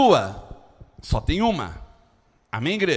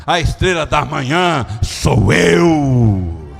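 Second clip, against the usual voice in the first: loud, drawn-out scripture proclamation; preaching at full force; 3 semitones lower, 5 dB louder, slower than usual. Acted. Preacher voicing Jesus to the church, forceful proclamation. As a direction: proclaim it slowly, building to a huge held shout.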